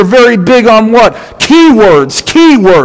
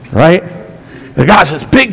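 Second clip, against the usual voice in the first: ordinary talk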